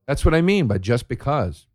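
ordinary delivery

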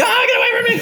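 very high comic shriek of panic; mock terrified scream. Human waking to a spider on the pillow, mock-panicked scream. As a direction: scream it high as if recoiling, laughing underneath.

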